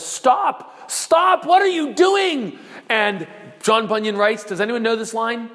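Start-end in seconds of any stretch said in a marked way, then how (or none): 0.0-2.6 high, loud shout of stop. Retold or imitated. Wife shouting after her fleeing husband, high and loud. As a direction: call him back in alarm. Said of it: "she shouts after him and says".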